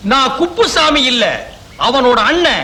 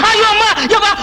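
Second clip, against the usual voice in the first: panicked wail; 7 semitones higher, faster than usual. Acted. Panicking at being caught.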